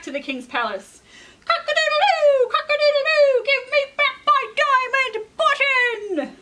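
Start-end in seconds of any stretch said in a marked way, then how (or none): 1.4-6.4 high, loud rooster crow and shout; crowing rooster demand. Acted. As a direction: crow it out, then demand the button back. Rooster crows again.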